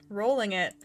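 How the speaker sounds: usual speaking voice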